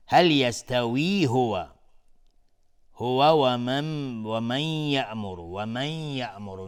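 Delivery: slow, melodic, drawn-out chant; Quran recitation. Shown as a demonstration. Reciter chanting the whole verse slowly, melodic and devout. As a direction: let each phrase ring out, slow and devout.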